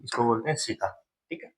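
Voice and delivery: normal talking voice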